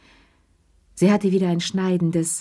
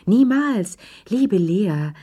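high, louder exclamation; emphatic protest; 4 semitones higher, 4 dB louder, slower than usual. Acted. Visitor denying she would disturb Lea's love dream, emphatic protest. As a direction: cry it out in showy denial.

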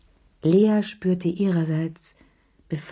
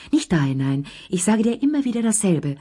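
mildly raised scolding call; 3 semitones higher than usual. A narrator's reading. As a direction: scold him sharply across the room. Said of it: "rief Lea". (Lea ruft ihn scheltend zur Ordnung)

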